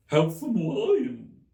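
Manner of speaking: very high, loud and slow, coaxing; encouraging March Hare. A narrator's reading. March Hare offering wine, high slow and encouraging. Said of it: "in an encouraging tone".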